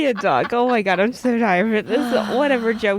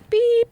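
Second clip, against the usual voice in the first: voicemail beep. Retold or imitated. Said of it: "it was like".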